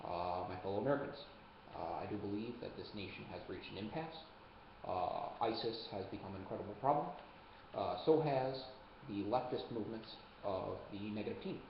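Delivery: mock presidential address, barely changed from his own voice; Obama impression. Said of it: "that's my Obama impression".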